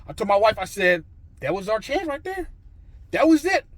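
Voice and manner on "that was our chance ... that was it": high-pitched, loud-ending lament; retold dismay. Husband telling wife the chance is gone, high dismay.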